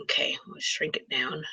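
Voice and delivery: very soft, low, near-whispered self-talk; muttering to herself. Real, not acted. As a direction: murmur it under your breath while busy. Mumbling to self while working screen.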